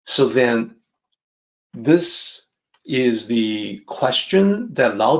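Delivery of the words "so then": normal talking voice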